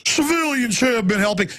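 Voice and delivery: mocking mayor voice. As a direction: lightly mimic the mayor's smug tone. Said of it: "our mayor here in Austin all the time going".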